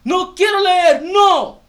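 put-on high, loud, slow kid's whine with a laugh breaking through; whiny child refusal. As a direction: pout out the refusal like a stubborn little boy.

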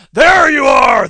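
guttural bellow. A narrator's reading. Monster finds its prey, guttural bellow. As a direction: bellow it like a brute on the attack. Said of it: "the guttural voice bellowed".

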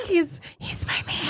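breathy swooning whisper; hushed, dreamy whisper about her man. Pretend. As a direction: whisper it like you're smitten.

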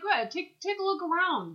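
high, casual captain voice; breezy invite; Kirk impression. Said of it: "Kirk's like".

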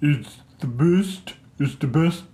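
halting, hammed-up impression of a boastful politician; shaky-confidence presidential impression, played for laughs; Donald Trump. Shown as a demonstration. Podcast host attempts a boast about the rating system, amateur Trump impression. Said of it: "I was gonna do my Trump impression".

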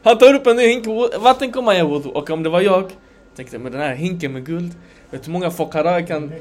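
ordinary talk

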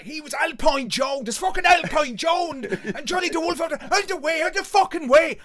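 very high, very loud, breakneck announcement; frenzied shouting. Retold or imitated. Lads passing off two mates as stars, frenzied shouting. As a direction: yell it to part a crowd.

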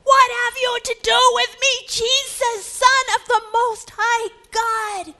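high, ringing shout; crying out loud. Acted. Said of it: "crying out in a loud voice".

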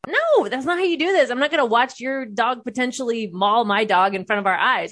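re-enacted protest, starting high and forceful; indignant refusal. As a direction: shoot down the idea in a retold argument. Retold quarrel with brother over dogs.

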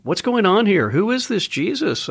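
high-pitched puzzled questions; puzzled outsiders wondering aloud. Retold or imitated. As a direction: voice the curious onlookers, pitch lifted well up. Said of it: "they're wondering".

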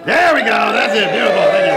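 loud, high encouraging shouts; big cheer. Real, not acted. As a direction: call it out across the stage. Cheering a performer on stage.

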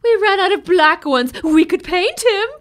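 high, loud put-on teacher voice; mock cheerful teacher; voicing the teacher. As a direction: chirp it like a chipper teacher. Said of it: "quoting the teacher".

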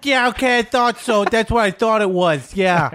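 very high-pitched mock Jersey accent. Imitating a TV clip from Jersey, high Jersey accent. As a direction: squeal it in an exaggerated Jersey accent. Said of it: "showing a clip from Jersey".

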